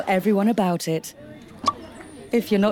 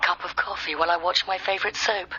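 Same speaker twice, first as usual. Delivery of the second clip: breathy vintage purr; 5 dB quieter than usual. Acted. Sexy phone line hostess teasing.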